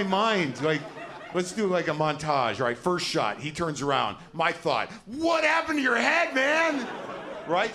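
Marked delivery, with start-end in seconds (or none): 5.1-6.9 high, loud startled gasp of a question; sudden high loud shock at the scar. Retold or imitated. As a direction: blurt it out in shock. Imagined thought on seeing scar.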